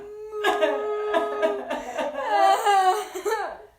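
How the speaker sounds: high loud fake wailing; hammed-up blubbering sob. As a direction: blubber like a big sad bear. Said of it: "the bear blubbers on".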